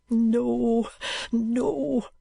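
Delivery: high, hushed, dejected whisper; a broken boy giving up, breathless; faint high whisper of defeat. A narrator's reading. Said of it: "said the dejected creature".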